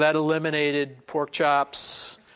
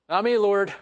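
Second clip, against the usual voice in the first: playful refusal; 5 semitones higher, 4 dB louder than usual. Acted. Peter refusing the Lord.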